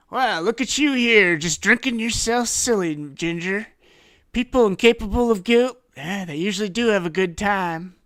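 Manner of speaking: loud, high-pitched needling taunt; taunting detective. Acted. Detective crowding a drunk suspect, loud and taunting. Tarnish taunts Ginger up close.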